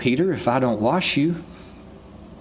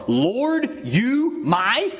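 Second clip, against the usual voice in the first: mock Peter; 8 semitones higher, much slower than usual. Peter's challenge, Yoda-like word order.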